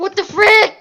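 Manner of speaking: sudden loud, high what the frick; exasperated outburst. Real, not acted. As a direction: blurt it out, fed up. Frustrated by technical difficulties.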